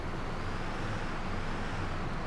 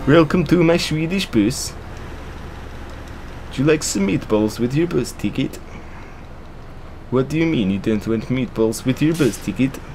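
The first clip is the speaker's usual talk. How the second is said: hammed-up Swedish accent, softly lilting; bad Swedish accent; 5 dB quieter than usual. Swedish bus driver offers passengers meatballs, bad Swedish accent. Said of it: "that's enough bad Swedish accents".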